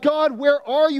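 mock desperate call upward; calling out to God. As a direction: cry out lost in the storm. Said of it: "you're calling out to him".

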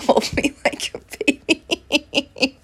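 slow words breaking into real laughter; laughing while talking. Real, not acted. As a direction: giggle through the confession. Giggling at her own clinginess.

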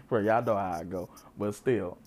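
usual speaking voice

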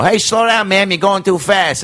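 high, slow hammed-up New York cop warning; mock cop. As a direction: blow the whistle and scold her like a cartoon cop. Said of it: "I would do this bit".

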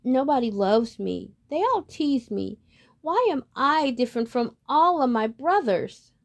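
lonely duckling. Acted. Lonely duckling cries to himself.

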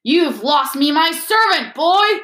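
high, loud, slow rage; furious outburst. A narrator's reading. Master who has lost his servant lunging at Harry, furious. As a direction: rage at the boy, peaking on the last word.

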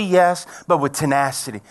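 usual speaking voice

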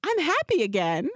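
put-on high cheerful child voice; imitating a smiling daughter at drop-off; happy kid. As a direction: sing it out like a beaming toddler. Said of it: "she goes".

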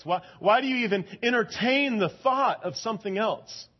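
high, loud preacher's plea; fervent sermon build; impassioned preaching. Acted. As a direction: drive the question home from the pulpit. Preaching about idols.

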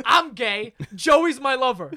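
imitated bold declaration, half laughing; said out loud. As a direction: announce it to the whole class. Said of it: "Says this out loud in class".

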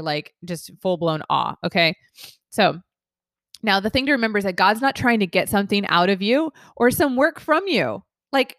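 usual speaking voice

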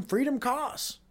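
freedom costs lifted high like a slogan; hammed-up patriotic pitch, rising on freedom. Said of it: "can then say".

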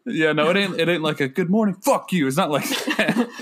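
laughing protest. Real, not acted. Protesting, laughing, to his wife.

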